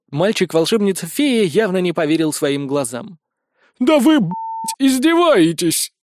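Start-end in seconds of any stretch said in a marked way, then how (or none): 3.8-5.9 high, drawn-out indignant cry; outraged disbelief. A narrator's reading. Wizard learns of bridge after crossing lava, outraged. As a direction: exclaim it in outrage, stretching the last word.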